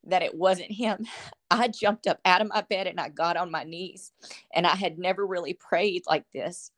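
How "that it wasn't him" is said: holding back tears while telling it; tearful. Real, not acted. Telling her friend about hearing Jesus, tearful and breaking. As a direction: voice high and breaking with tears, real. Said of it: "And I'm so sorry if I cry".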